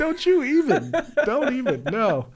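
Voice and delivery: very high, loud playful scolding; mock indignation. Real, not acted. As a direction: shut down the teasing in a high squeal. Flustered protest at friend's teasing.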